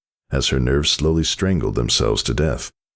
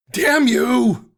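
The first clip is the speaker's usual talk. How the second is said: high strained outburst; cursing in pain; 3 dB louder than usual. Acted. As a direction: spit it back through the pain. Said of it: "she answered the voice".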